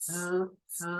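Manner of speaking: z with the teeth closed, repeated; buzzing z. Shown as a demonstration. Teacher repeats z with teeth together for students. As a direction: bite down and buzz out z twice. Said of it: "bite your teeth first and accent".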